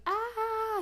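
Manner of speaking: short mock scream; sharp high yelp. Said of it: "they're like".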